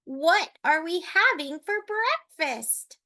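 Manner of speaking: put-on high old-lady voice; cheery Granny. A narrator's reading. Granny asking the wolf about breakfast, high and cheery. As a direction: ask it high and chirpy.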